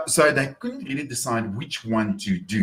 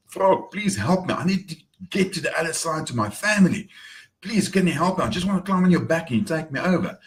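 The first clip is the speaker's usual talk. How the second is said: higher-pitched, earnest begging; acted plea in a raised voice; pleading scorpion; 6 semitones higher than usual. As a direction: plead with the frog for help. Scorpion begging frog in story.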